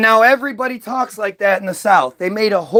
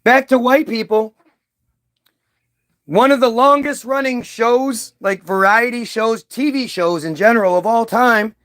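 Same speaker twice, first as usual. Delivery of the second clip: usual speaking voice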